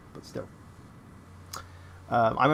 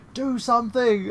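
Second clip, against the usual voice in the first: brother's higher, louder panicked order; urgent plea; 10 semitones higher, 3 dB louder, slower than usual. Retold or imitated. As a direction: bark it in mock panic. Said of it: "he's like".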